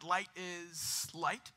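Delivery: slow emphatic. Shown as a demonstration. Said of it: "slow emphatic definition".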